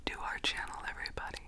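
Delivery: soft unvoiced ASMR whisper. Real, not acted. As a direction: whisper close to the mic. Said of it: "the A S M R voice".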